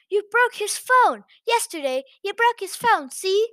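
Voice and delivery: high, loud, insistent blaming; angry accusing chaperone. Acted. Chaperone insisting the narrator broke the phone, loud high accusation. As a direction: jab the blame at her, loud.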